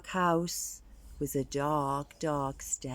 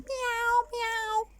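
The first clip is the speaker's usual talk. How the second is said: very high mock meow; meowing like a cat. Skeletons scaring the dog skeleton, high cat meows. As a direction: mew like a cat to scare the dog. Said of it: "they're going".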